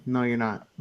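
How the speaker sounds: ordinary talk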